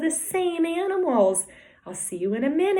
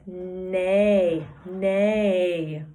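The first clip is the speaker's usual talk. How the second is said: low, drawn-out "neigh"; horse neigh; 6 semitones lower than usual. Shown as a demonstration. Teacher showing children what the horse says, low drawn-out neigh. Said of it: "And he says".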